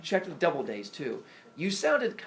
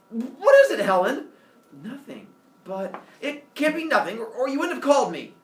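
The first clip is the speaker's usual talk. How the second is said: irritated husband; 11 semitones higher, 12 dB louder than usual. Acted. Husband snaps at his wife.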